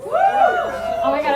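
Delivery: high, drawn-out gush; excited squeal. Real, not acted. Comic to Scorpio fans in the crowd, high and gushing. Scorpio fan answers yes.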